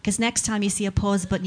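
ordinary talk

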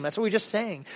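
normal talking voice

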